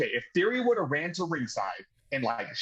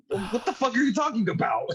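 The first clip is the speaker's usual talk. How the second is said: incredulous; 9 semitones higher than usual. Retold or imitated. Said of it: "They got us, man"?".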